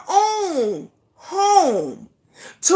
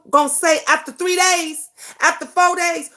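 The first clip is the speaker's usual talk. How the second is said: normal talking voice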